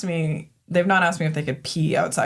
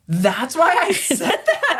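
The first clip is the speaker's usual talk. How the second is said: laughing retort; 10 semitones higher, slower than usual. Retold or imitated. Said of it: "I'm like".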